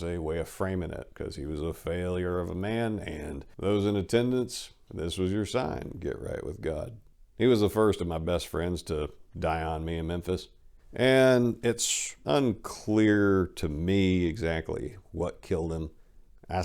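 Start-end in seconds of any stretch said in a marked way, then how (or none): none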